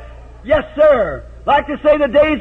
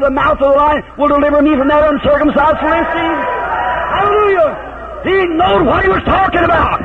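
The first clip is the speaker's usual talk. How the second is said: preaching at full force; 3 semitones higher than usual. Acted. Preacher shouting to the congregation about divine healing, at full force. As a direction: let it build into a full revival shout.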